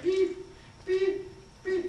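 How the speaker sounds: high short machine beeps; steady high beep, beep, beep; EKG beeps. Shown as a demonstration. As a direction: chirp like a heart monitor. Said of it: "like an E K G machine".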